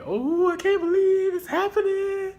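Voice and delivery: high, drawn-out thrilled squeal; gushing like a starstruck fan. Retold or imitated. Meet and greet excitement.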